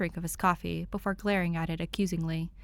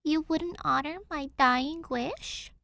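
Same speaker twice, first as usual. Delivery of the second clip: put-on higher girl's voice, slow and put-upon; pouting Danny; 9 semitones higher, slower than usual. A narrator's reading. Danny pouts at Tim over her dying wish, slow mock hurt. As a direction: sulk the question out slowly. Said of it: "Danny pouts".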